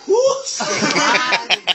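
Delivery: high squealing laughter; laughing hard. Real, not acted. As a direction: crack up completely. Cracking up at memory.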